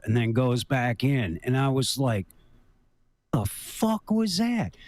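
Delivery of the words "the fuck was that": startled. Retold or imitated. Sees giant thing rise from water.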